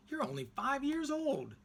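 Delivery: high, incredulous preacher; grown-up amazed at a small boy; preacher's high surprise. A narrator's reading. Preacher voiced in story.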